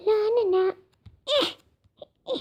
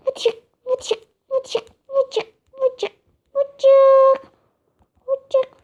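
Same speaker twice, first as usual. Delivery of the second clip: higher "vıçık" squish sounds; squishy jumping noise; 5 semitones higher, much slower than usual. Acted. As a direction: bounce with silly squish noises. Jumping sound effect words. (zıplarken tiz "vıçık" sesleri)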